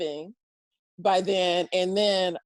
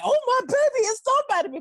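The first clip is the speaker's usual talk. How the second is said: much higher, a touch louder, doting mother; proud mom voice; 3 dB louder than usual. Retold or imitated. Imitating her mom finally proud of her, high doting voice. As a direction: gush like a mom bragging about her child.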